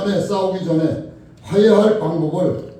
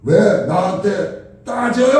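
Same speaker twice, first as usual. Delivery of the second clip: loud, high, drawn-out complaint; shouted protest; 4 semitones higher, 6 dB louder, slower than usual. Retold or imitated. Woman refuses the man's complaint, shouted protest. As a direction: yell it slowly, stretching the words. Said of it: "소리를 지르는 거예요".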